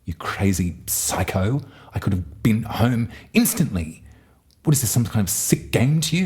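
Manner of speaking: imitating a sketch rant, rising to a shout; Dorothy furious. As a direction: ham up a furious Dorothy yelling at the witch. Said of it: "Mad T V sketch where Dorothy's like".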